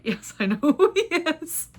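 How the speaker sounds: high, loud, drawn-out retort breaking into laughter; laughing incredulity. Retold or imitated. Telling a friend she knows the famous man, laughing incredulity.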